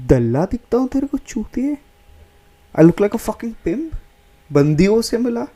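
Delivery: high-pitched, hammed-up outrage; mock indignation. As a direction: play the offended Delhi dude, voice shooting up. Acting Delhi dude offended by request.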